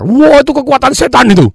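high, loud shocked cry; alarmed exclamation. Retold or imitated. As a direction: gasp it out in superstitious alarm. Imitating people's superstitious reaction.

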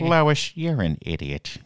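a hint of Peter Griffin, "Lois" called high and loud; lightly voiced cartoon dad scolding. Friend imitating Peter Griffin scolding Lois, lightly voiced. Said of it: "Peter Griffin".

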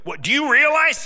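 high, loud disbelief; incredulous king. Acted. King Saul disbelieving the boy, high and loud. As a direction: bark it out in disbelief.

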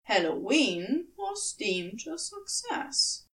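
usual speaking voice

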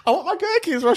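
put-on squeaky high girly whine; high mock girl voice. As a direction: pitch it way up like a whiny girl. Mimicking girl wanting gherkins.

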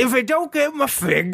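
high mock English accent, mildly cross; English lady. Complaining about missing figs, high English lady accent. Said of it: "subdued English lady".

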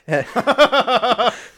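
loud laugh. Real, not acted. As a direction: burst of high laughter. Host laughs at own joke.

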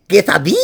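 "Quesadilla!" loud and high with a Spanish th for the s; Spanish pronunciation. Retold or imitated. Girlfriend turning into a different person, loud Spanish "Quesadilla!". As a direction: belt "Quesadilla!" in proud Spanish style. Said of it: "She'd be like".

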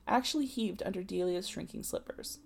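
ordinary delivery, nothing marked

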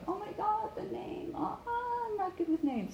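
high-pitched mock panic; flustered fear. Listener at a networking event hearing a name coming, flustered. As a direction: panic at having to catch a name. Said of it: "get over that fear".

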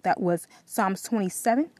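ordinary talk, nothing marked

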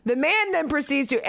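usual speaking voice

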